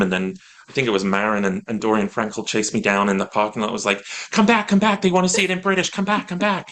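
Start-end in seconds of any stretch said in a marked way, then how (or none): 4.3-6.7 calling after him. Retold or imitated. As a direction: call him back across the parking lot. Casting directors chasing him down.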